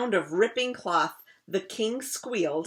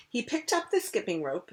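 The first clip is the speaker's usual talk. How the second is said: usual speaking voice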